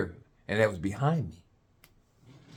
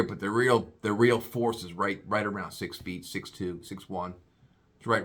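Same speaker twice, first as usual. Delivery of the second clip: ordinary talk, nothing marked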